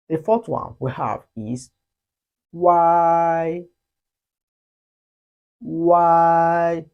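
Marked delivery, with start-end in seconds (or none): 2.5-6.8 loud, held wei for students to copy; Chinese wei. Shown as a demonstration. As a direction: model the syllable clearly. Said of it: "The U sound must be read before".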